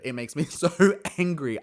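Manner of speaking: half-laughing real frustration; getting heated. Real, not acted. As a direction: boil over on the word angry. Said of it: "I'm just getting heated".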